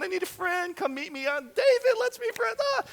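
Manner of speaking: very high, wimpy mock-pleading voice; hammed-up lonesome falsetto whine; mock lonely. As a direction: imitate a needy weakling begging for company. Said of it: "They weren't, like, lonely".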